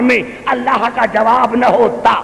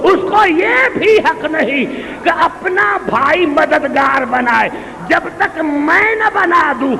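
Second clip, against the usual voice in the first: higher, shouted sermon climax; preaching at full force; 8 semitones higher, slower than usual. Acted. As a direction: drive the principle home to the crowd. (پورے جوش سے خطابت)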